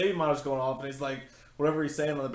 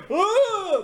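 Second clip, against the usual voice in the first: high, loud monster groan; monster growl; 6 dB louder than usual. Retold or imitated. Mock demon groan describing the intruder scene. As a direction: let out a creepy demon groan. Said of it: "he's like".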